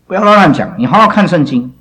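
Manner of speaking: loud, high rebuke to the class; scolding. Real, not acted. Teacher scolds students for careless Bible reading, loud and stern. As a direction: scold them for talking nonsense.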